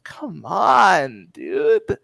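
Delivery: high, coaxing con-man voice; wheedling schemer. Acted. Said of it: "the other one just replies".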